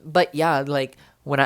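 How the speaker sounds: usual speaking voice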